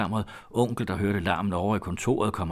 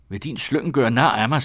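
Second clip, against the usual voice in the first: furious, raised and high-pitched outburst; rasende skrig; 6 semitones higher, 7 dB louder than usual. A narrator's reading. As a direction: cry out, enraged at being mocked. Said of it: "skreg han". (rasende, højt udbrud)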